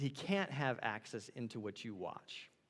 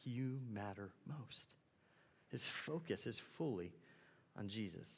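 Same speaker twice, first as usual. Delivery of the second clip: hushed reverence; 3 semitones lower, 9 dB quieter, slower than usual. Acted.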